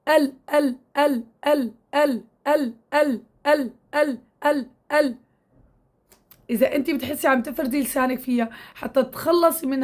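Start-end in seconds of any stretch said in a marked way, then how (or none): 0.1-5.2 repeated ال، ال with the tongue tip on the gum; repeated lam drill. Shown as a demonstration. Teacher drills ال for the student to copy. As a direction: drill ال over and over, tip only. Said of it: "جيبي هيك".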